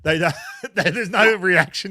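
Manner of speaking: laughing out loud through the line. Real, not acted. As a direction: crack up over the dead silence. Laughing at awkward silence.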